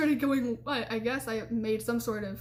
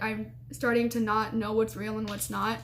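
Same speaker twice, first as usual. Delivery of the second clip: ordinary delivery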